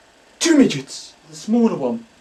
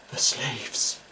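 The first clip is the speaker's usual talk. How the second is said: ordinary delivery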